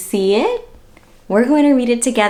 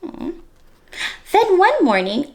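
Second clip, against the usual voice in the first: usual speaking voice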